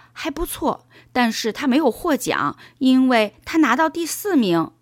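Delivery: put-on young boy answering. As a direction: answer dad as an earnest schoolboy. Narrator voices boy Paul answering. (小男孩高声回答爸爸)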